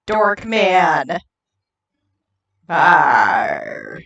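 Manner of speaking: low, slow growling pirate. Acted. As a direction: menace them like a hammy sea pirate. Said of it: "your appearance as Nalthus".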